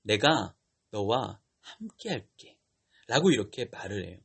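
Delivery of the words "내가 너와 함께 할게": slow, low, gentle voice of God; God reassuring. Retold or imitated. God comes directly to doubting Gideon, low and slow. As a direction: reassure slowly, pitched well down. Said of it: "기드온에게 뭐라고 하냐면은".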